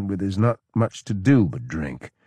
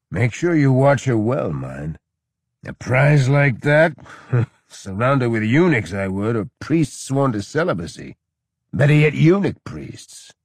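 louder, higher, boozy lecturing; higher, louder drunken counsel; loud drunk advice; 5 semitones higher, 4 dB louder than usual. A narrator's reading. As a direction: play the sloppy drunk giving advice. Drunk general mock advising.

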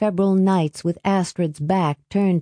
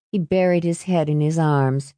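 plain reading voice

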